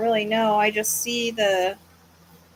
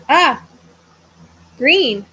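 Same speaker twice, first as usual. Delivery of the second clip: sudden loud gasp of realization; surprised; 4 semitones higher, 9 dB louder than usual. Real, not acted. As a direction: blurt it out, caught off guard. Sudden realization while searching map.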